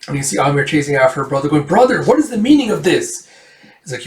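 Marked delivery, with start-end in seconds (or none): 1.6-3.3 high-pitched, urgent call; indignant demand. Retold or imitated. Sister chasing after her brother, indignant. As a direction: act out a sister's raised-voice protest.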